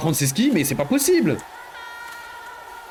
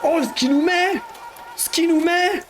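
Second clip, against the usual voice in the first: real shocked shout; 11 semitones higher than usual. Real, not acted. Gamer stunned as a rival skier overtakes, shouting high. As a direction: yell it out, genuinely stunned. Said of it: "j'ai crié un peu fort".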